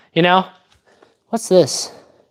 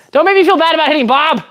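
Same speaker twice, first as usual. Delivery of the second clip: higher, rushed comic whine of protest; mock protest; 7 semitones higher, faster than usual. Streamer defends hitting Bob to viewers, high mock protest. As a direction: protest in a playful, high-pitched rush.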